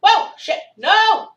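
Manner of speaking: startled loud cry; being chased. Real, not acted. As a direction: yell in sudden alarm. Falls off ledge mid-chase.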